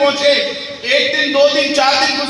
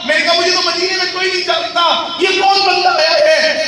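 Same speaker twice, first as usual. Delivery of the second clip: ordinary delivery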